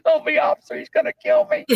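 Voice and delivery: very high, shrieking mock panic; terrified pleading. Panhandler begging the officer not to kill him, shrieking. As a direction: squeal it like you think you're about to die.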